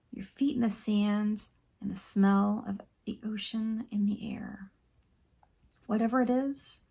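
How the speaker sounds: soft, calm meditation guide with long pauses; hushed, soothing relaxation voice; guided meditation. Acted. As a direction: gently lead them through breathing and reflection, leaving space.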